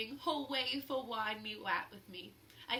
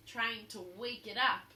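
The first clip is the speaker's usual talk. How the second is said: ordinary delivery, nothing marked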